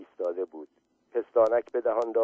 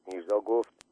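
plain reading voice

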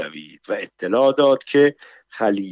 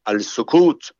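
mildly raised, stern command; a touch of a scolding shout. A narrator's reading. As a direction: call for silence with restrained force. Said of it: "ابرو در هم کشید و فریاد زد".

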